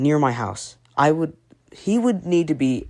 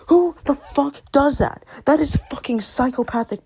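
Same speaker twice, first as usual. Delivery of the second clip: outraged outburst; 9 semitones higher than usual. Real, not acted. Ranting about Gumball stomping picture.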